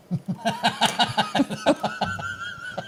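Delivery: high-pitched giggling laugh; high squealing laugh. Real, not acted. Laughs at the blind roofer joke.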